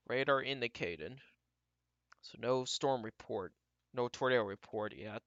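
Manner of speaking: ordinary talk, nothing marked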